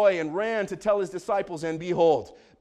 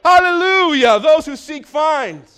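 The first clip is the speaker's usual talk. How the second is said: high, loud preacher's cry; full-force preaching; 7 semitones higher, 10 dB louder than usual. Real, not acted. Preacher rousing the congregation to say hallelujah, full-force preaching.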